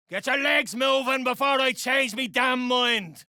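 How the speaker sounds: high, barked command to get moving; gruff order. Acted. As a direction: shoo the freed squaddies off, loud and high. Freeing prisoners, ordering them off.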